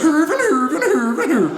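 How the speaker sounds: herven, herven chanted high with Swedish lilt; Swedish accent. Retold or imitated. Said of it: "incredibly accurate Swedish impersonation".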